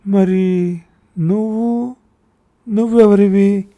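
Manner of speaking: high, loud, drawn-out bewildered question; a frail mother lost, demanding who; bewildered old mother. Acted. Mother baffled asks who he is. (తికమకతో వృద్ధ తల్లి)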